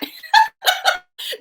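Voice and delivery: high burst of laughter at her own story; bright giggle after the quote. Real, not acted. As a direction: laugh out loud, amused.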